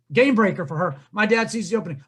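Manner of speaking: ordinary talk, nothing marked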